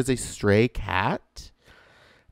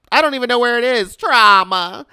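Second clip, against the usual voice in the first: dramatic exasperation; 12 semitones higher, 7 dB louder than usual. Real, not acted. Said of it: "because trauma".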